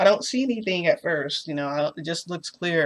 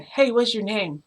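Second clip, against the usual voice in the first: drawn-out mock yell, pitched higher; mild imitation of yelling to someone unseen; retold yell; 6 semitones higher, 3 dB louder than usual. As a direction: call out slowly as if through a closed door. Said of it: "So I yell again".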